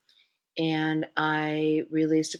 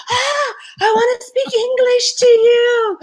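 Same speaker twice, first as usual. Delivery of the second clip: high, loud, drawn-out squeal of delight; gushing excitement; 8 dB louder than usual. Retold or imitated. American spotting a fellow American abroad, gushing excitement. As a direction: cry it out high and slow, thrilled.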